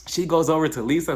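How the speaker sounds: ordinary talk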